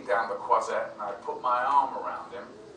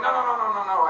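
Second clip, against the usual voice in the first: high, loud string of protests; hasty denial; 9 semitones higher, 5 dB louder, much faster than usual. Retold or imitated. Imitating a director denying the accusation, high and insistent. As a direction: wave the idea away in a raised voice.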